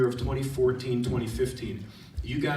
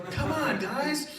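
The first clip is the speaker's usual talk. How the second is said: high, eager coaxing call; playful high-pitched beckoning; excited calling; 13 semitones higher, much slower than usual. Retold or imitated. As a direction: urge friends to join the fun. Calling students to run along.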